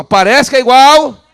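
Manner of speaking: slow, high, full-volume shout. Shown as a demonstration. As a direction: shout it for the crowd to echo. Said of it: "Vamos falar bem alto?".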